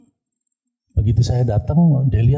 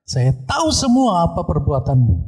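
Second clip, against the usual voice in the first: raised-pitch demonic accusation; orang kerasukan setan; 6 semitones higher than usual. Retold or imitated. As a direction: accuse them as the possessed person, pitched up. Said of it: "dia bilang seperti itu". (menuduh seperti orang kerasukan, nada naik)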